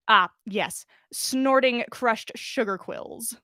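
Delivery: usual speaking voice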